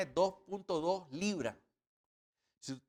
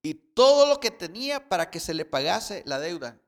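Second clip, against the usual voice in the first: loud, emphatic sermon reading; fervent preacher voice; forceful preaching; 8 dB louder than usual. Acted. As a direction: preach the parable out to the congregation.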